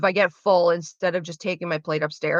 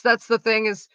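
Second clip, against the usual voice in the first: ordinary delivery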